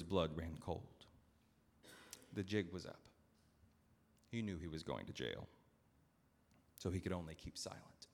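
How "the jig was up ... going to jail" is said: ordinary delivery, nothing marked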